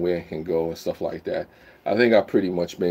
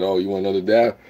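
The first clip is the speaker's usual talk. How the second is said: normal talking voice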